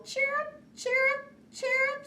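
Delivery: very high chirping squeak; squeaking chicks. Acted. Baby birds chirruping from the kite, very high squeak. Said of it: "squeaked the chicks".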